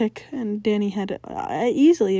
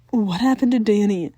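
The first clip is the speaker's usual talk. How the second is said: demanding; 7 dB louder than usual. A narrator's reading. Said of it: "Maddie demanded".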